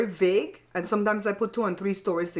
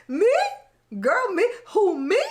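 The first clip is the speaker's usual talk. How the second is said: much higher, louder who me; mock disbelief; 11 semitones higher, 4 dB louder, slower than usual. To her sister, much higher mock innocent disbelief. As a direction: play innocent, squealing high. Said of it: "I said".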